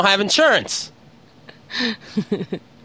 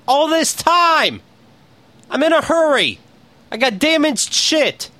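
frustrated rant; 9 semitones higher, slower than usual. Real, not acted. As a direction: vent your frustration. Ranting about insurance calls.

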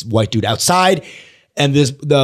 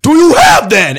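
much higher, loud, slow furious yell; angry shout; 7 dB louder, slower than usual. Retold or imitated. Homeless man yelling at a stranger who has nothing. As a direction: blow up at him out of nowhere. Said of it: "And he's like".

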